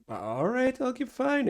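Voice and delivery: put-on soft, rising high-pitched old-timer; softly voiced obliging older man; old barkeep. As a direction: give in quietly, voice lifting high. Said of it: "And he's like".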